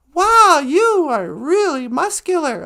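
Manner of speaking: slow, loud, swooping compliment; gushing admiration. Acted. Two friends admire a construction guy, gushing admiration. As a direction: lay the admiration on thick.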